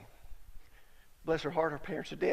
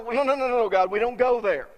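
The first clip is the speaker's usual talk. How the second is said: mock refusal; 4 semitones higher, 10 dB louder than usual. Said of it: "we go".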